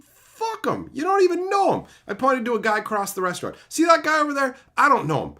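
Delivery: high, loud, scornful telling-off; retold loud rant. Telling the Arby's lady how he deals with haters, loud and scornful. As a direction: brush the haters off at full volume. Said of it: "I said".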